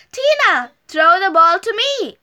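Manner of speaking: an eager puppy calling for the ball, loud and high; mild story-time shout; excited shout. A narrator's reading. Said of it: "shouted Fluffy".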